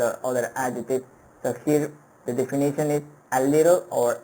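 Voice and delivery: normal talking voice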